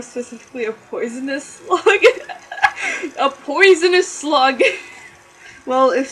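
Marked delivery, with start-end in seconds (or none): 1.4-4.7 loud laughing exclaim; giggling it out. Real, not acted. Laughing at own joke.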